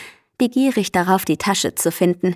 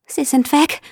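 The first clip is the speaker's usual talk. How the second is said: alarmed cry; 8 semitones higher than usual. Acted. Miller finds the eggs gone.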